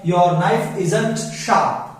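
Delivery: very slow, each word spelled out; slow model sentence. Shown as a demonstration. Teacher reads a sentence slowly to the class. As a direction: read it slowly for students. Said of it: "इसको sharp नहीं बोलेंगे".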